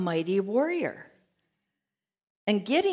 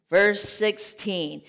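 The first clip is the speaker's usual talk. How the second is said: ordinary talk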